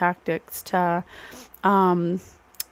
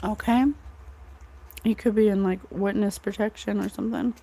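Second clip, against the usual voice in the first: usual speaking voice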